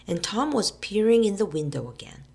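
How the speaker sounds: plain reading voice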